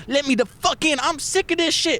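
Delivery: very high mock yelling; high-pitched impression of an angry woman; imitating a fed-up woman. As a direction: squeal it like she's had enough. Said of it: "I hear she's like".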